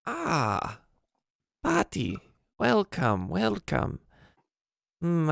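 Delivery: put-on high-pitched elderly goblin greeting; high old goblin voice, knowing; old goblin woman. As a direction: welcome them as an old goblin crone. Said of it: "the old goblin looks up and goes".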